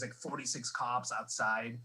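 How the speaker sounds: normal talking voice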